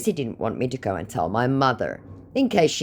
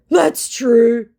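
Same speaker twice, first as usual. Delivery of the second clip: high, loud put-on voice; hammed-up grudging agreement; mildly defeated scammer; 11 semitones higher, 8 dB louder than usual. Scammer conceding to her in a high mock voice. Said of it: "mildly defeated".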